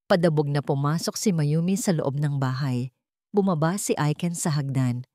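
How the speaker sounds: ordinary delivery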